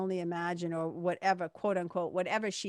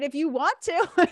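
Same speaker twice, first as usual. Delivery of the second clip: higher, louder, breaking into laughter; laughing reply; 10 semitones higher, 8 dB louder than usual. Real, not acted. Speaker answers the cashier's joke, real laughter. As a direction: laugh along as you answer.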